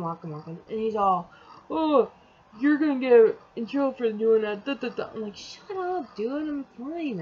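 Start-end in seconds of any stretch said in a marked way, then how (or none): 1.7-5.1 louder imitation of a nag; mock scolding. Imitating him warning her of trouble, mock scolding. 5.4-7.2 higher, retold brush-off; exasperated retort. Retelling her answer to his scolding, exasperated.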